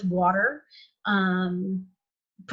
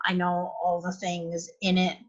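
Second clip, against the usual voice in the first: ordinary delivery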